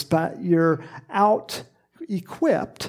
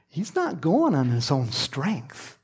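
ordinary delivery

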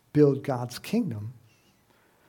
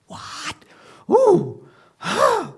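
high, loud hammed-up outrage; indignant; 8 dB louder, much slower than usual. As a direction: gasp and splutter, scandalized. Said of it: "They were indignant".

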